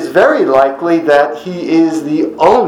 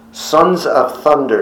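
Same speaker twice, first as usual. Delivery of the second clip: usual speaking voice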